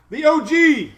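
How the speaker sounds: high, loud happy shout to the dog; excited call. Real, not acted. Greeting the old dog excitedly.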